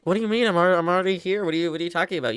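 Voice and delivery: mock indignant protest. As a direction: play innocent with the boss. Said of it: "Be like".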